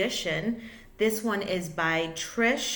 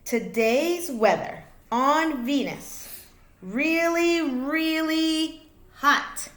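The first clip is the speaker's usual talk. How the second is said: a hint of a TV weatherman, really stretched slow; mock weather report; 7 semitones higher, 5 dB louder, slower than usual. Announcing Venus weather to children like a mock forecaster. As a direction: play weather reporter for the kids.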